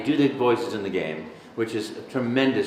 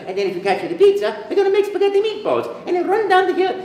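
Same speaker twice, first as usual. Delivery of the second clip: very high, cartoonish Italian accent, gleeful; Mario; 4 dB louder than usual. Shown as a demonstration. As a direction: babble joyfully like a squeaky Italian cartoon plumber. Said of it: "I could do an Italian voice that's a little bit nicer".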